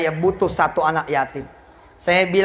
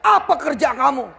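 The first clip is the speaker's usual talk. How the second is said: higher, heated mock scolding; angry demand; 7 semitones higher than usual. Creditor angrily scolding the debtor for not paying, heated. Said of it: "marah-marah sama saya".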